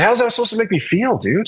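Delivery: high-pitched, fast baffled comeback; incredulous. Retold or imitated. Retold reply to a fan, high and incredulous. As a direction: squeak out a disbelieving reply at the fan.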